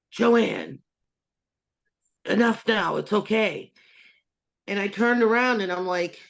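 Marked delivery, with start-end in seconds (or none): 0.1-3.7 dad's voice. Retold or imitated. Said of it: "I just heard in his voice clear as day".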